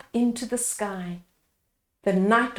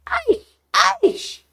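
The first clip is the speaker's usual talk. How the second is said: put-on much higher, scolding old man; old man warning; 14 semitones higher, slower than usual. A narrator's reading. Old man checks the villagers, scolding. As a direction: hold them back like a fussy elder. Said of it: "said the old man".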